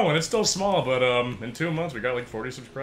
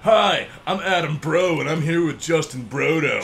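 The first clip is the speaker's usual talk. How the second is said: bro announcer; 4 dB louder than usual. Pretend. Mock show intro as bros.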